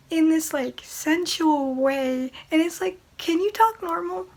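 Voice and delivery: ordinary delivery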